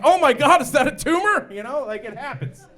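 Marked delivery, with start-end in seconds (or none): 0.0-1.5 high, very loud mock alarm; horrified shout. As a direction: cry out in hammed-up shock. Acted horrified reaction at mirror.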